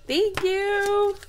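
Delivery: high, bright burst of thanks; excited thanks. Real, not acted. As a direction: squeal a thank-you, delighted. Opening a surprise gift card.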